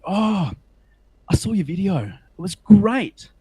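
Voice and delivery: imitated gushing carers. Carers at school praise the video, mock gushing. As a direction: gush with slow, stretched praise.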